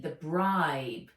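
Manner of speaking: plain reading voice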